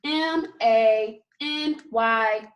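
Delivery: each letter said slowly and clearly; slow spelled letters. Shown as a demonstration. Teacher spelling a sight word slowly for the class. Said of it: "Let's spell it".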